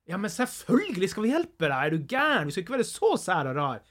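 cheerful north Norwegian dialect voice; friendly north Norwegian accent, lively. Retold or imitated. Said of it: "som nordlending, en annen nordlending".

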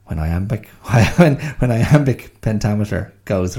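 laughing at the flubbed meter. Real, not acted. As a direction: say it while still laughing. Laughing at own mistake.

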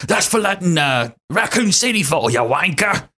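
hammed-up Cockney insult; thick Cockney accent. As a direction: do a thick, cocky Cockney game-character voice. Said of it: "he has, like, a very thick accent".

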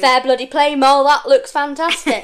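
loud, hearty pat on the back; praising herself for her work, a loud hearty cheer; self-praise cheerleader. Pretend. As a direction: cheer yourself on like a proud coach. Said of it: "I don't talk like that".

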